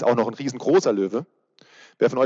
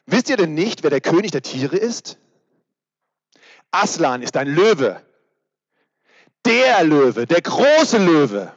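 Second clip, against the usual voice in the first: higher, louder beaver voice, slowing to a grand finish; beaver reading aloud; 4 semitones higher, 6 dB louder, slower than usual. Acted. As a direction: announce the lion with awe. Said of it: "Der Biber spricht". (Biber, laut und feierlich, am Ende sehr langsam)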